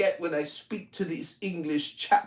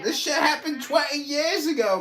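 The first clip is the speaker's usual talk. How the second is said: normal talking voice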